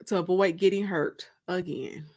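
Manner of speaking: usual speaking voice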